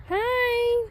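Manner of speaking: high squeaky hello; high greeting. Real, not acted. Greeting a horse with a high hi.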